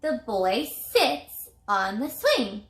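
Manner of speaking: slow, careful reading, every word clear; slow model sentence. Shown as a demonstration. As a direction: read it slowly for young readers. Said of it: "model sentence read slowly".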